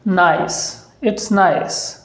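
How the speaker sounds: "नाइस" with the vowel drawn out; stretched vowel. Shown as a demonstration. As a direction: pull the vowel long in "नाइस". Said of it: "थोड़ा सा खींच करके".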